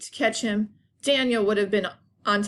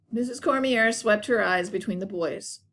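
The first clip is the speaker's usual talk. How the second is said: plain reading voice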